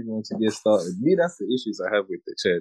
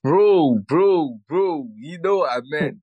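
raised, pleading bro; loud protest; 6 semitones higher, 5 dB louder, much slower than usual. Real, not acted. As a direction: protest loudly, drawn out. Protesting friend's joke.

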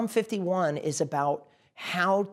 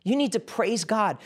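ordinary talk

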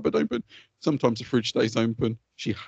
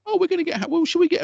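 very high-pitched mock wife voice; imitated wife's suggestion; 3 dB louder than usual. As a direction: mimic a wife floating an idea, pitched way up. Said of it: "then she was like".

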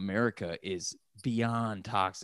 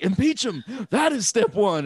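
much higher and louder insistent voice; mock demand; 10 semitones higher, 6 dB louder than usual. Mocking people now demanding impeachment, loud insistent voice. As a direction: insist on it like a protest chant.

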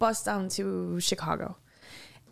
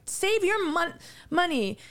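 mom protesting; 7 semitones higher than usual. Retold or imitated. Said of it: "Going on and on about".

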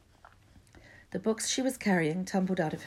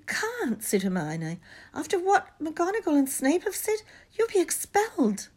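alarmed; 12 semitones higher, 4 dB louder than usual. A narrator's reading. Hermione pleading, alarmed.

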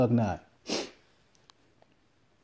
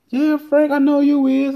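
high, loud mimic of his buddy; buddy's voice; 14 semitones higher, 5 dB louder than usual. Retelling his buddy Deej at the bar, mimicked high and loud. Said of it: "He like".

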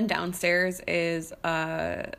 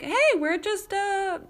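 much higher, louder, easygoing party voice; imitating Ellie; 10 semitones higher, 4 dB louder than usual. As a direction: play the carefree neighbor, up high and breezy. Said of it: "And she was like".

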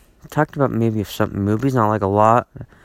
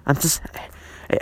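whispered exasperation; 3 semitones higher, 7 dB quieter, much slower than usual. Real, not acted. Exasperated aside, sighing.

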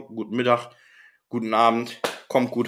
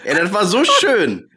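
laughing; 9 semitones higher, 7 dB louder than usual. Real, not acted. Laughing after partner's gag.

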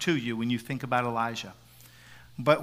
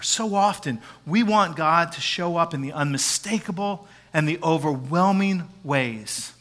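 ordinary talk, nothing marked

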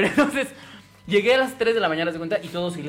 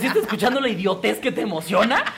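high incredulous mock retort; mocking disbelief at a dumb boast; retold scoff; 5 semitones higher, 4 dB louder than usual. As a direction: scoff at the friend's excitement, laughing. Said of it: "Y yo así como".

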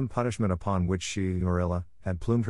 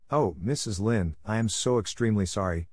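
plain reading voice

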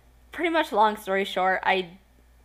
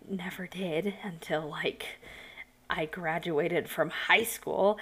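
slow whispered confession; whispering; 2 semitones lower, 6 dB quieter, slower than usual. Real, not acted. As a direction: whisper it slowly. Confessing how long it lasted.

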